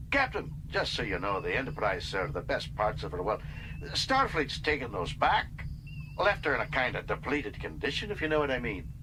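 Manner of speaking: lightly voiced old engineer breaking mild bad news; Scotty cautions Kirk about the ship's condition; Scotty's voice.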